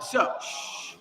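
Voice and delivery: whispered shh to quiet the choir; hushing. Real, not acted. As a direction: hush them gently in a whisper. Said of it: "hushing the choir".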